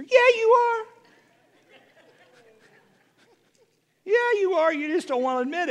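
high, punchy retort, teasing; sassy comeback. Acted. Preacher answering a listener's denial, high teasing comeback. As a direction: snap back playfully.

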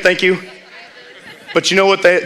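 ordinary talk, nothing marked

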